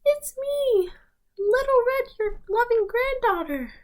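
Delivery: a wolf badly pretending to be the granddaughter; wolf faking a girl. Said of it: "And the wolf says".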